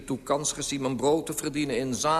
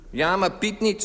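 high, raised voice; insistent, pitched-up retort; loud protest; 9 semitones higher, 5 dB louder than usual. A narrator's reading. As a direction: object loudly from down in the street. Father protests up to the window.

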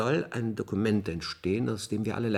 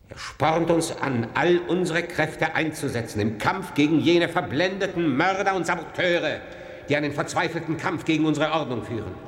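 rousing call to fight; 7 semitones higher than usual. Acted. Eulogy turns into a rallying call.

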